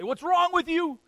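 high, loud cry of alarm at the sleeper; panicked shout. Retold or imitated. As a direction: yell at him to wake, in a panic. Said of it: "rouse them out of their sleep and say".

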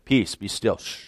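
soft, hushed stilling of the storm; quietly calm the sea with a hush; a gentle hush to still the waves; hushed calm command. Retold or imitated. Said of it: "Jesus standing up and saying".

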